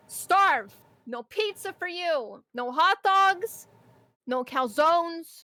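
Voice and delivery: slow, high, loud taunting yells; mock fury. Cook threatening customers with no food, mock angry yell. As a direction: spit out each banned food like a curse. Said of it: "some days where I'm just like".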